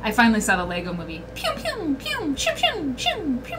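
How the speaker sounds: normal talking voice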